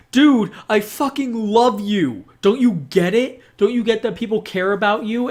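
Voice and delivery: a hint of Ichiban begging him to listen; lightly voiced hero insisting he cares; Ichiban pleading. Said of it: "But Ichiban's like".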